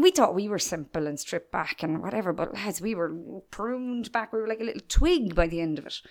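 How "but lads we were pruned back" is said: normal talking voice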